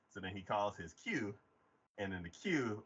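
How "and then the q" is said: normal talking voice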